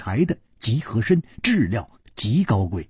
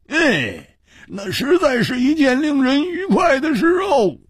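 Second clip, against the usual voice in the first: sighing; 8 semitones higher than usual. A narrator's reading. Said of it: "长叹了一声说".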